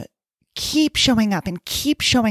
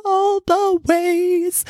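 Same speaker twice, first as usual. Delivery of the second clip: much higher, slow, drawn-out gush; 12 semitones higher, much slower than usual. Real, not acted. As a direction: stretch it out with delight. Gushing about a favourite show.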